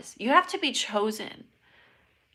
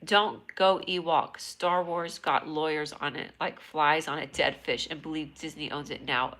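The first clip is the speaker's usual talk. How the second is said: normal talking voice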